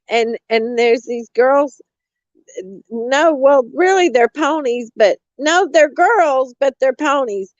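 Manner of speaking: mocking caller voice. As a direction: ham up the frantic caller reporting a stalker. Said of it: "I'm gonna try to reenact it".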